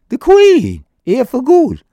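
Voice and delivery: high, loud mock disbelief; incredulous high-pitched echo of the news; astonished questioning. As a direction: act out amazed double-checking. Retells own disbelief at the news.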